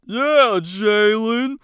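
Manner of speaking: loud, slow jeering taunt; mocking taunt. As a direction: taunt her like a jock. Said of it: "one of the football team teases".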